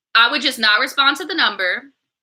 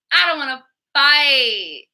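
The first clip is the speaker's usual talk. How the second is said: mock crying; 3 semitones higher, slower than usual.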